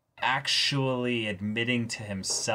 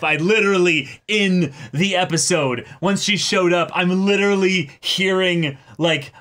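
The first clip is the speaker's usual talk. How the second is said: louder, higher, rushing with delight; excited; 7 semitones higher, 6 dB louder than usual. Real, not acted. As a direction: geniet er hardop van. Excitedly describing meme edit.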